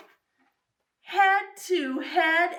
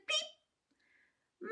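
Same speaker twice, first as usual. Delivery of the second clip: very high, soft little beep; high soft squeaky beep; tiny chick beep; 11 dB quieter than usual. Acted. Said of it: "her chick said".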